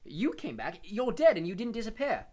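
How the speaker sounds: loud, high accusation; angry. A narrator's reading. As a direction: accuse him angrily. Harry angrily accusing Nick.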